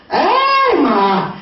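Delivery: high, held "oh" dropping away; mock shock. Woman seeing her date's profile, hammed-up shock. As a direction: gasp it out in hammed-up astonishment.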